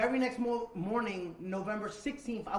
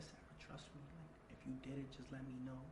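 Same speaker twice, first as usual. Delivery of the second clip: 1 hushed plea; 4 semitones lower, 15 dB quieter than usual. Retold or imitated. Pleading quietly with girlfriend.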